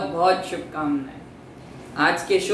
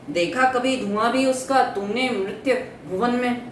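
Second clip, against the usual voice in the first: higher-pitched, measured declaiming of verse; declaimed Hindi verse, pitched up; poetry recitation; 4 semitones higher than usual. Acted. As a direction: recite as a doubting questioner, voice lifted. Recites the poem as Menka's questioning.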